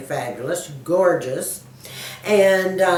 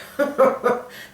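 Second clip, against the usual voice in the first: amused laugh; 8 semitones higher than usual. Real, not acted. Woman asked if she works, amused laughing. Amused by question about working.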